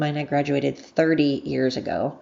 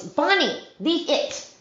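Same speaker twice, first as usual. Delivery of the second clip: much higher, real command to a pet; dog command; 11 semitones higher, slower than usual. Real, not acted. Owner to dog pulling off the cone, commanding. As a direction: break off to correct the dog.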